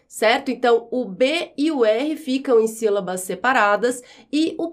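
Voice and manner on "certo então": ordinary delivery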